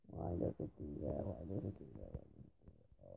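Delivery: very low, very soft grumble; defeated muttering. Real, not acted. Player asking the game why, quiet defeated mutter. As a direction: mutter it to yourself, fading to almost nothing.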